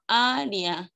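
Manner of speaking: "área" stretched out slowly for the class; syllable demo. Shown as a demonstration. Teacher giving pupils a hiatus example, syllable demo. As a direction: sound out "área" with a long first "a". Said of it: "dos vocales en sílabas diferentes".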